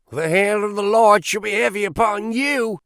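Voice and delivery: high, loud hammed-up wrathful prophecy; cried out. A narrator's reading. Young Drebber threatening the farmer, loud wrathful cry. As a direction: call down the Lord's wrath on him. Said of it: "cried young Drebber".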